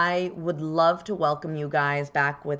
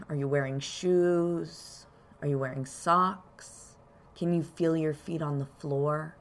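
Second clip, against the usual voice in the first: calm grounding exercise; 4 dB quieter than usual. Acted. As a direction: guide them gently into their body. Leads a grounding meditation.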